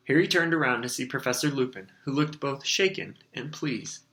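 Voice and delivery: plain reading voice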